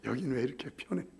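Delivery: quick, soft mocking complaint; tossed-off grumble; lightly imitated complainer; fast mock grumble. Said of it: "그렇게 말하더라고".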